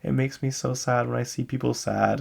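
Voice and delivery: lightly voiced young fan pleading to help people; little girl voice. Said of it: "She's like".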